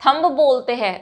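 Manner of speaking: ordinary talk, nothing marked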